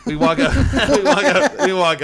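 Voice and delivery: laughing through the words, high and loud; can't stop laughing mid-sentence; giggling retelling. Real, not acted. Laughing while starting the story.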